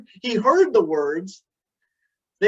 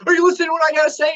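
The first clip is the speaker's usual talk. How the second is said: fast, higher, louder snap; snapping wife; 9 semitones higher, 5 dB louder, much faster than usual. Retold or imitated. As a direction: snap at him for not listening. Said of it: "And Cindy snaps".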